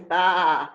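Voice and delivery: low, loud mock bah; sheep bleat.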